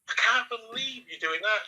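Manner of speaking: high, slow mock disbelief; exaggerated retold shock. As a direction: mimic being stunned she lights up. Shocked at her smoking.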